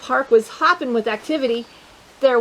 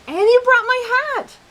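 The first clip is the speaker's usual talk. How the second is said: much higher and louder, thrilled; thrilled kid; 12 semitones higher, 3 dB louder than usual. Acted. Sam thanking Bigsby for the hat, high and thrilled. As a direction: squeal with joy over the hat. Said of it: "Sam said".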